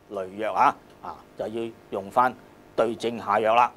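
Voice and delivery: ordinary talk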